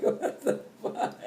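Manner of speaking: breathy, laughed-through whisper; laughing whisper. Real, not acted. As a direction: wheeze it while laughing. Laughing quote.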